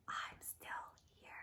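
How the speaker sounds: eerie ghostly whisper, barely audible. Acted. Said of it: "she hears a whisper saying".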